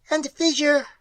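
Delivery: high, shrill alien reply; shrill it like a strange creature. A narrator's reading. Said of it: "shrilled the mystery".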